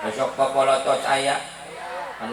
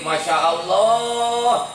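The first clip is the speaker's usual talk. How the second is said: delighted wife; 5 semitones higher, much slower than usual. Pretend.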